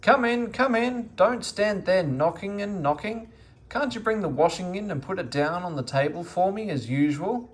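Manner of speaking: loud, high call through the door; old dame calling loudly at the door. A narrator's reading. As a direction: call out impatiently to the knocker. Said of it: "Dame Grumble called loudly".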